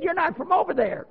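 very high insistent elder voice; imitated elder lady. Elder lady rejecting his conversion, imitated high protest. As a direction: insist in a high, put-on old lady's voice. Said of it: "one of the elders there in the community".

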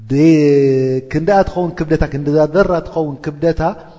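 ordinary delivery

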